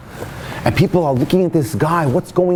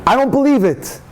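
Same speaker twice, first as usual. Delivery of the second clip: astonished joy; 6 semitones higher, slower than usual. Retold or imitated. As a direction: burst out in amazed delight. Ex-husband hears wife is pregnant.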